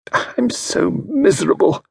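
a touch of misery; miserable. Acted. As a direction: lament her lot. Mrs Packington.

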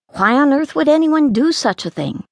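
ordinary delivery, nothing marked

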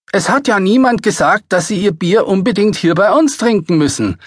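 high, loud and snippy waitress. A narrator's reading. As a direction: toss it back with cheek. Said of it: "meinte sie schnippisch".

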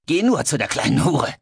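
high, hateful jibe; spiteful insult. Acted. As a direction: spit the insult out. Hate-filled friend insults, then spits.

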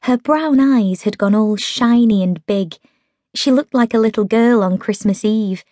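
ordinary delivery, nothing marked

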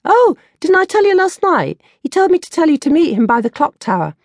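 high silly schoolgirl voice; girlish friend Jazz. Acted. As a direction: play Jazz as a giddy, oblivious best friend.

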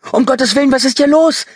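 high, loud, hysterical woman's voice; panicked scream. A narrator's reading. Terrified woman demands answers from companion, panicked scream. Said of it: "schrie sie".

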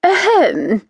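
a high, pointed little throat clearing; prissy attention-seeking ahem. Acted.

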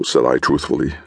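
plain reading voice

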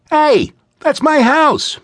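put-on high, loud, slow child's protest; a boy crying out that his house is being smashed. A narrator's reading. Boy protesting as the giant wrecks his house, high and loud. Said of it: "protested Thorley".

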